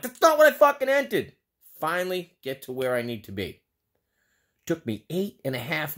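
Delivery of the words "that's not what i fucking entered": much higher and louder angry yell; furious outburst. Retold or imitated. Caller snaps at the automated phone system, furious and loud. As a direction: explode at the machine. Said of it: "I'm like".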